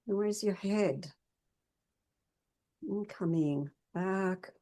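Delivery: soft, unhurried meditation guidance; guided movement meditation. Acted. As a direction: gently lead them through body awareness, leaving pauses.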